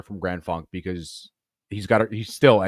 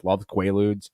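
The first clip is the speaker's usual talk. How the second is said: ordinary delivery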